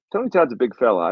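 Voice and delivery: ordinary talk, nothing marked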